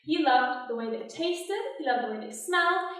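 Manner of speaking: normal talking voice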